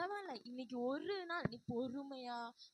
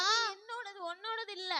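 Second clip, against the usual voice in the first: higher and much louder, defiant; shouted claim; 7 semitones higher, 10 dB louder than usual. Acted. Younger sister yelling that the biscuit is hers. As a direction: yell that it is hers.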